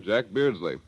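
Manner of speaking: ordinary talk, nothing marked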